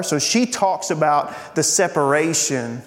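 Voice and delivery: usual speaking voice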